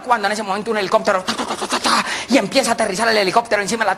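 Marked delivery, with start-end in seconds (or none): 1.2-2.1 rapid-fire "ta-ta-ta" rotor noise; helicopter imitation. Helicopter arrives over the concert stage, helicopter imitation. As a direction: imitate chopping rotor blades.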